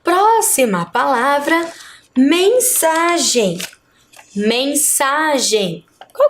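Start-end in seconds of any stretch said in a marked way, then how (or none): 2.2-3.6 "mensagem" very slow and higher, with "sa" stretched; stress on SA. Shown as a demonstration. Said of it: "O sa". 4.3-5.8 "Mensagem" very slow, syllable by syllable, long "sa"; stress on SA. Shown as a demonstration. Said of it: "O sa".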